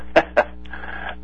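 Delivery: short, high, airy put-on laugh; mock laugh back. Caller shows how he should have answered her, mock laughing. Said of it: "laughed back at her".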